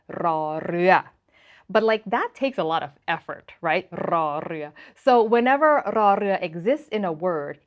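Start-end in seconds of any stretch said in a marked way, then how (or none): 0.1-1.0 trilled R opening "รือ"; tongue-trilled Thai "รือ"; hard rolled R. Shown as a demonstration. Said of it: "we do have a hard roll R". 3.9-4.7 trilled R opening "รือ"; tongue-trilled Thai "รือ"; hard rolled R. Shown as a demonstration. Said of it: "a hard roll R".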